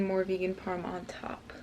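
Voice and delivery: unvoiced whisper through food. Real, not acted. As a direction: whisper the toppings softly. Describing toppings while eating.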